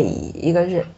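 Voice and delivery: ordinary talk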